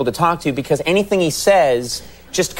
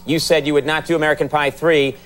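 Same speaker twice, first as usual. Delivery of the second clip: ordinary delivery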